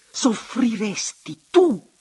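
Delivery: high, loud anguished outcry, drawn out; stifled, desperate shout; choked cry. A narrator's reading. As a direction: cry out as if half suffocated. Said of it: "gridò ella con voce un po' soffocata".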